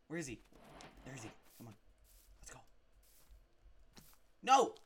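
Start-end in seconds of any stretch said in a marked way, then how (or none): 4.4-4.7 short, loud, high no; frustrated shout. Real, not acted. Player watching his pet slip away, shouted in frustration. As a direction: cry out in protest.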